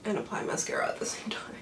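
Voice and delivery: soft breathy whisper. Real, not acted. Creator whispers to viewers mid-application, whispering. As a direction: whisper it while focusing on the brush. Whispering while applying mascara.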